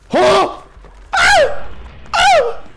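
loud high yelps of fright; startled screams. Real, not acted. Player jumped by the killer, screaming in fright. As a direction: burst into short, piercing screams.

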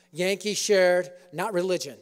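normal talking voice

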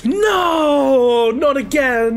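loud, high, drawn-out "no" of despair; dismayed wail. Real, not acted. Streamer facing Aether Currents again, theatrically dismayed. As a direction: wail in hammed-up dismay, falling gradually. Dreading a repeated game chore.

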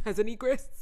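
laughing through the words; amused giggle at own pun. Real, not acted. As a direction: giggle the word out. Laughing at own word play.

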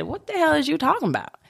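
high-pitched incredulous comeback; mock disbelief at puzzling advice; baffled retort. Retold baffled reply to therapist.

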